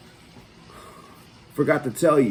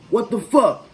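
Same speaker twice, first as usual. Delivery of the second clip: sharp high angry yelling; cussing him out; 8 semitones higher, 4 dB louder than usual. Retold or imitated. Retold curse-out at the other driver, loud and higher. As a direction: cuss out the driver who hit the car. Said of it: "I'm cussing dude out".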